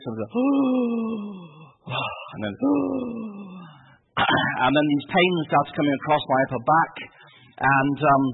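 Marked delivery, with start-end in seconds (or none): 0.3-1.5 high, drawn-out mock gasp for air; constricted gasp. Missionary shows his chest tightening, constricted gasp. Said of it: "chest was being constricted like this". 2.6-3.6 long, high-pitched imitated gasp; constricted gasp. Showing the audience the attack, constricted gasp. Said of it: "chest was being constricted like this".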